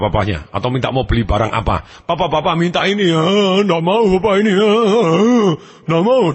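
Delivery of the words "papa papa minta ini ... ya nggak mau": put-on higher-pitched whiny kid begging papa; acted child's whine; whining child. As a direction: plead and whine like a spoiled child. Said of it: "dia nangis, dia merengek".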